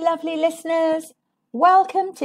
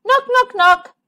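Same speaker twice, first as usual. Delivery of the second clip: much higher, bright spoken knocks; knocking at door; 11 semitones higher, 5 dB louder than usual. Acted. Friends knocking at porcupine's door, bright voiced knocks. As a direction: tap out the knocks with your voice.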